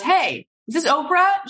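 high, lively phone greeting; Steven Spielberg. Retold or imitated. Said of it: "it's Steven Spielberg".

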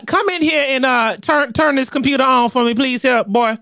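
put-on high old grandma calling a boy.

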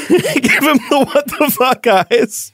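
high-pitched laughing repeat. Real, not acted. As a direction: echo the phrase through laughter.

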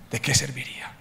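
hushed whispered question. Real, not acted. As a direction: drop to a whisper to let it sink in. Rhetorical question after the story.

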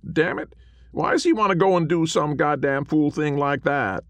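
high, loud cranky cowboy; cranky little ghost. A narrator's reading. Small man's grumbling voice.

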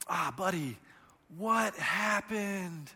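soft, slow, soothing question; tender father. Retold or imitated. Father comforting his hurt four-year-old, tender and soft. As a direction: coo it to a hurt child. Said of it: "I go like this".

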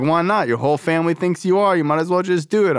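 tough sponsor voice. Retold or imitated. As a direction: bark out the tough-love lecture. Sponsor voice continues.

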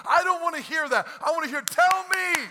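loud, fast, fired-up pastor; full-force preaching. Acted. Pastor pours out his heart to the congregation, fired-up preaching. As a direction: preach it hard and let it build.

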